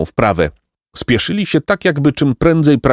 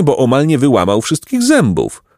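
plain reading voice

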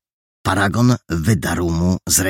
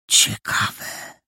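whisper. A narrator's reading. Perełka whispers his suspicion to his friend. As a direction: breathe it out as a secret. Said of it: "wyszeptał Perełka".

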